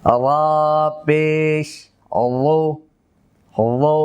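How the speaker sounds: "আল্লাহ পেশ" spelled slowly as "ra pesh", then a heavy "ro"; full r with pesh. Shown as a demonstration. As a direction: lift the tongue root for "ro" after "আল্লাহ পেশ". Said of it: "উপরের দিকে উঠাবো". ("আল্লাহ পেশ"-কে টেনে "রা পেশ, রো" পুর করে)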